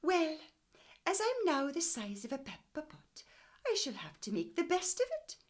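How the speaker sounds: put-on high, loud little old woman talking to herself; old woman's voice. Mrs Pepperpot talking to herself, high old woman voice. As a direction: voice the tiny old lady.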